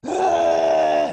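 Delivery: sudden loud imitation baby scream; loud imitated cry from the crib; baby's freak-out scream. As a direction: blast out a mock infant shriek. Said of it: "and start freaking out".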